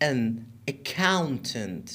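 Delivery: normal talking voice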